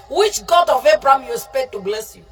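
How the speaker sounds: normal talking voice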